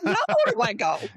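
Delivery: high-pitched mock dismay; hammed-up high plaint; retold devastation. Student kicked out of class, retold high-pitched dismay. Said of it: "I was devastated".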